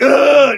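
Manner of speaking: high, loud "ugh"; mock groan of annoyance; exasperated groan. Acting out the owner picking up the Roomba, loud exasperated groan.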